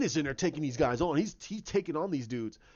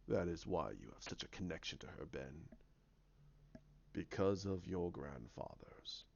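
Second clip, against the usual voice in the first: sinister villain; 8 semitones lower, 7 dB quieter than usual. Acted. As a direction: taunt quietly in a deep villain voice. Villain taunts Ben.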